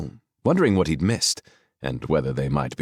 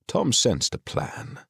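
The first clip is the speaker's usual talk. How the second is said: ordinary delivery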